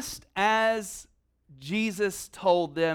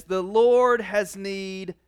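slow, solemn; slower than usual. Retold or imitated. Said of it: "you shall say this".

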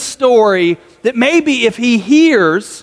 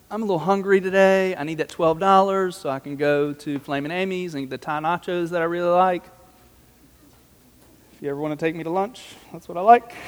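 offhand, quieter voice asking for the money; mock casual demand; 6 dB quieter than usual. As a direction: toss it off like it's nothing. Servant casually demanding twelve dollars.

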